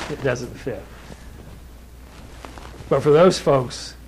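normal talking voice

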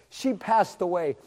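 usual speaking voice

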